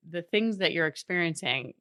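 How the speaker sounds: ordinary talk, nothing marked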